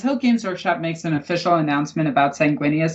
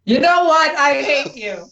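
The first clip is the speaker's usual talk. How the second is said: high, loud wounded outburst; mock outrage; 10 semitones higher, 4 dB louder, slower than usual. Teased friend to the one mocking the break-up, mock-hurt outburst.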